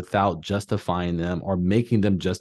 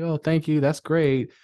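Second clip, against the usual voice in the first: mildly pleased thanks; 6 semitones higher than usual. Retold or imitated.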